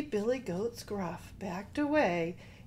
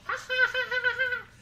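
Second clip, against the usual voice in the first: high, acted goat cackle; mocking goat laughter; 5 dB louder, faster than usual. A narrator's reading. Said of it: "And they laughed at the troll".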